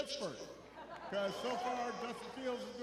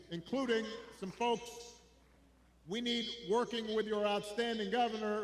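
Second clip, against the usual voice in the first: normal talking voice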